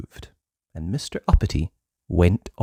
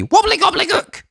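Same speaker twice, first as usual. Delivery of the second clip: very high, fast squeaky nonsense; goblin gibberish spell; 4 dB louder than usual. Acted. Goblin chanting a spell over Uppity, very high and squeaky. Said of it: "said backwards".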